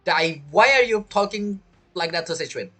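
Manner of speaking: normal talking voice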